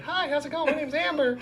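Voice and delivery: put-on high-pitched chirpy teen phone greeting; teenage girl. Store girl answering the customer's call, high teen voice. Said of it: "this, like, sixteen-year-old girl comes on".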